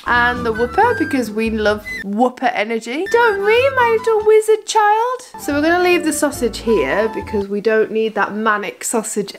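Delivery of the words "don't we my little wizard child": talking to a dog. Real, not acted. Cooing at the dog.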